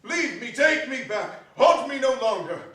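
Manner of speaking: loud, pleading and desperate; anguished plea. A narrator's reading. Scrooge begging the ghost to take him home, anguished. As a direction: beg the spirit to stop.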